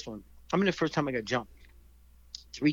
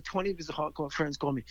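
ordinary talk, nothing marked

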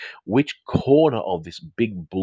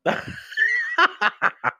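high cackling laugh; burst of laughter. Real, not acted. Host reacting to a brave comment, high laughing fit. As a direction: crack up helplessly.

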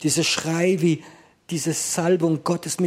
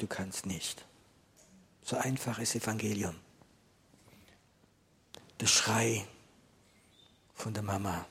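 hushed preaching; 2 semitones lower, 6 dB quieter than usual. Acted. Pastor quietly confiding to the congregation about fear, hushed. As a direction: preach as if sharing a secret, barely voiced.

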